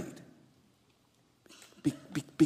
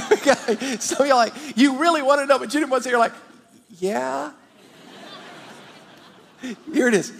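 laughing; 6 semitones higher than usual. Real, not acted. Laughing teasing the congregation.

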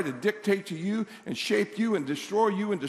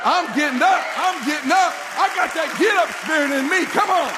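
high, loud rallying shout; full-throated revival cry; preacher's climax shout; 10 semitones higher, 8 dB louder than usual. Real, not acted. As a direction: shout it as a triumphant comeback. Sermon climax rallying the crowd.

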